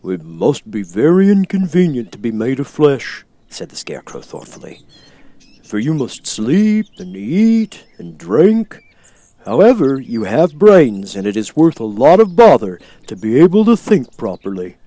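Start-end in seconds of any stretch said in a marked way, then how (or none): 0.0-3.2 louder straw man voice, musing; a thoughtful Scarecrow. Acted. Scarecrow speaks. 5.6-14.8 louder straw man voice, musing; a thoughtful Scarecrow. Acted. Scarecrow speaks.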